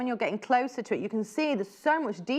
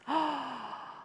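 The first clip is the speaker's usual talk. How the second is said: soft gasp of awe; 4 semitones higher, 7 dB quieter than usual. Real, not acted. Reacting to fabric.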